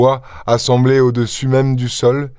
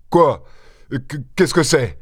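higher-pitched, stuttered question; stammering; 4 semitones higher than usual. A narrator's reading. Maître Goussot rushing to the basin, stammering. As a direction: stumble on the first sound, startled. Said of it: "balbutia maître Goussot".

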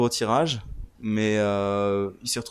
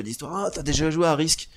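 bright, high eager question; eager fan; 6 semitones higher than usual. Retold or imitated. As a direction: imitate a keen gamer asking. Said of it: "je dis".

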